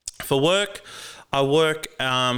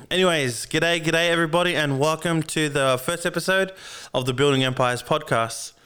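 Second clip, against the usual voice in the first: ordinary talk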